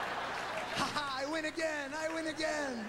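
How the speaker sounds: high, smug singsong taunt; gloating kid voice. Acted. Kid taunting a parent, high gloating singsong. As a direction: crow it like a bratty kid who won.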